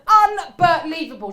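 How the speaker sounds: loud, slow, high outburst of disbelief; imitating an outraged heckler; livid. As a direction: drag the word out in mock fury. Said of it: "She's livid".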